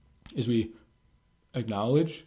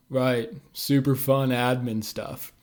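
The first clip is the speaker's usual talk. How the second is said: slow, dry mocking of the word fun; sarcastic; slower than usual. Speaker brushing off a coworker's payroll day, sarcastic. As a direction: drag it out to mock the task. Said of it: "I just sarcastically responded with".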